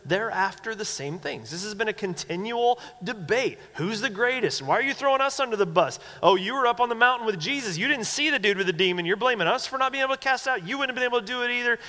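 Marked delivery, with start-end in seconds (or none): 3.7-11.8 fast, high-pitched squabbling; mock bickering. Disciples fighting over who's greatest, fast mock bickering. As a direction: imitate rapid petty blame-trading, pitched up. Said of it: "this constant fighting and backbiting".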